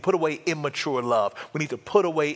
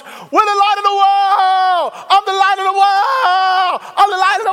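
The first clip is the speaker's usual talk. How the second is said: very high, loud, slow shouting; screaming joyfully; 8 dB louder than usual. Retold or imitated. Robert running down the street, screaming with joy. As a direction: yell it down the street at the top of your lungs. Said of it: "screaming to the top of his lungs".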